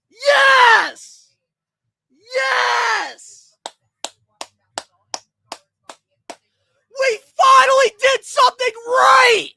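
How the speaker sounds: high, loud, drawn-out yells; ecstatic screaming. Real, not acted. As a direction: howl with joy, voice cracking. Fan celebrating draft pick.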